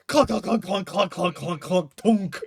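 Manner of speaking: loud, high clunks tumbling down to a final thunk; imitated tumbling clunks. Game master voices the character's fall downstairs, loud clunking. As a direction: bang out each clunk of the fall. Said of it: "just, you hear a".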